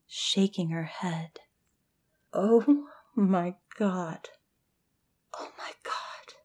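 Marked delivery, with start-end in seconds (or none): 2.3-6.3 horrified whisper. A narrator's reading. Hannah at her friend's lifeless body, horrified whisper. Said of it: "Hannah whispered".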